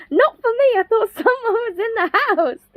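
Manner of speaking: high-pitched mock protest; indignant retelling. Housemate thought someone was in the house, indignant. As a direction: imitate an alarmed complaint, half laughing.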